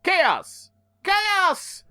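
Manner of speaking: high, hammed-up call of the name; dramatic call. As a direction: cry the name out like a comic villain. Calling out villain's name.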